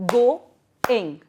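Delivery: "Go-ing" as two separate beats; split into syllables. Shown as a demonstration. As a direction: say GO, pause, then ING, firmly. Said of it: "break the word into two parts".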